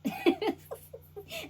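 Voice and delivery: real laugh; high laugh. Real, not acted. Laughing at reading.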